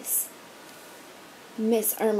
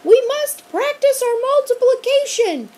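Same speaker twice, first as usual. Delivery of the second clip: high, loud sing-song teacher voice; creepy strict teacher calling; teacher calling after fleeing students; monster teacher; 13 semitones higher, 7 dB louder than usual. A narrator's reading.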